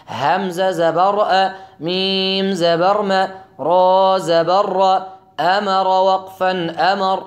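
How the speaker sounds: slow, loud, syllable by syllable; slow spelling drill. Shown as a demonstration. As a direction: spell each letter out slowly, like a Quran teacher. (تلفظ آهسته و بلند حرف به حرف)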